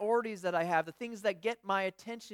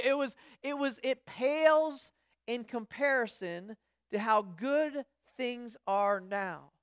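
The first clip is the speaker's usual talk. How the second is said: ordinary delivery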